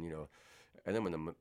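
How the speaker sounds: usual speaking voice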